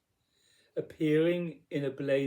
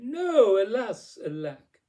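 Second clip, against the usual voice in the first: loud high wail, falling away; crying out; 9 semitones higher, 9 dB louder than usual. A narrator's reading. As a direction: cry out in theatrical dismay, then sink. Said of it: "The prince cried".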